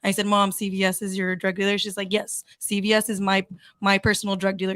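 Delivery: ordinary delivery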